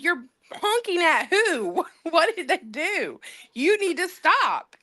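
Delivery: high-pitched incredulous telling-off, laugh breaking in; amused retold scolding. Wife retelling the honking argument, incredulous scolding. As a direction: replay the bafflement while tickled.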